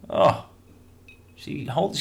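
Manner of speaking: usual speaking voice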